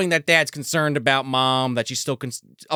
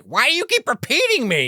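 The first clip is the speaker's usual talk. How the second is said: high-pitched mock exasperated mom; upset mother; 13 semitones higher, 3 dB louder than usual. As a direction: challenge the caller in a raised mom voice. Mom upset at echoing caller.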